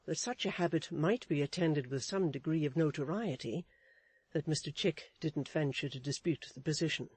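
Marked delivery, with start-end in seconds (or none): none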